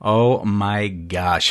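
normal talking voice

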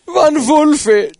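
high, mock-German exclamation; wicked German accent. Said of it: "does a very wicked German accent".

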